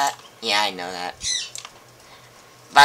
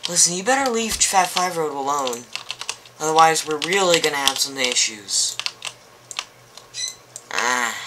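ordinary talk, nothing marked

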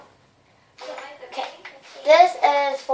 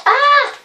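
a high, loud yelp of surprise; startled cry; 13 semitones higher, 5 dB louder than usual. Real, not acted. Door slams in her face, startled yelp. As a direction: jump at the door slam.

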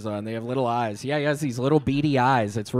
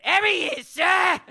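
high, yelped mock Australian accent; slower than usual. Shown as a demonstration. Comic demonstrating his Australian accent, high and hammed-up. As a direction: call it out in an exaggerated Australian twang. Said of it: "That's my Australian accent".

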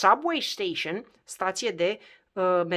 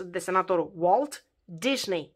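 ordinary talk, nothing marked

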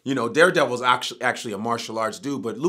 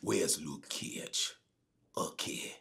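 very soft, slow, whispered mockery; 9 semitones lower, 16 dB quieter than usual. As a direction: breathe it out like a lame villain. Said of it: "every scene was like".

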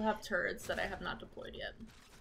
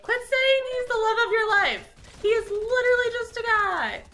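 very loud, high sassy squeal; high-pitched, squealy; 13 semitones higher, 12 dB louder than usual. Acted. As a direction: shriek it high, with attitude. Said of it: "Very high-pitched, very squealy".

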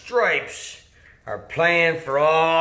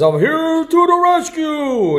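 heroic Superman; 8 semitones higher, 3 dB louder than usual. Acted. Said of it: "Superman says".

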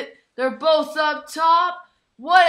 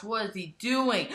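normal talking voice

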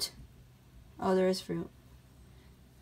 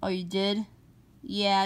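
normal talking voice

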